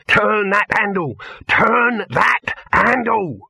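high, slow, loud yell; screamed order. A narrator's reading. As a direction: yell each word as a furious command. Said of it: "screamed Dibbler".

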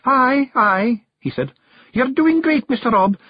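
high wee Feegle voice; a tiny voice flattering the chief; Awfully Wee Billy. Acted. Wee Billy flatters Rob, in a high wee voice.

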